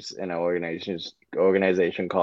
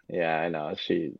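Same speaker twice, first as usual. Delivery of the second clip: ordinary talk, nothing marked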